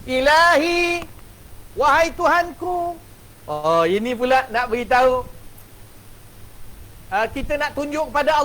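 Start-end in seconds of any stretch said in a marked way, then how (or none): 0.0-3.0 seru "Ilahi" perlahan dan lantang, penuh rayuan; intonasi doa. Shown as a demonstration. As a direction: panjangkan "Ilahi, wahai Tuhanku" seperti merayu. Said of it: "Tengok intonasi suara Buya". (nada doa yang memanjang)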